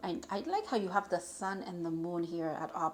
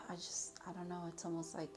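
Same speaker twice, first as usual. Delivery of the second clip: whisper; 10 dB quieter than usual. Real, not acted. Searching for words mid-reading.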